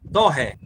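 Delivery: dó light, ré accented; accent only on ré; stress on ré. Shown as a demonstration. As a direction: drop the accent on dó, hit ré. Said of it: "deixa só o acento no ré".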